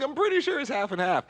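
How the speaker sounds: squeaky high mock impression. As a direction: mimic him way up high. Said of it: "I'm like".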